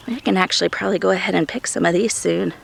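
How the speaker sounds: ordinary talk, nothing marked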